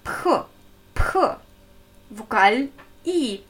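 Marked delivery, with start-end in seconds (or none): 0.0-1.2 P as a short puff of air; isolated aspirated P; aspirated consonant. Shown as a demonstration. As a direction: demonstrate P alone with a breath release. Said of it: "Angesaugte Konsonant".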